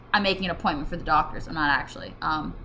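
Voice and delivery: ordinary talk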